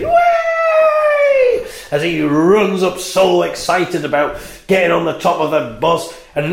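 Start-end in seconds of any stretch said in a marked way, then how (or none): 0.0-1.6 hammed-up high yelp of glee; high child whoop. Boy running up the bus stairs, hammed-up high whoop. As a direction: whoop like a kid racing upstairs. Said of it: "He's like".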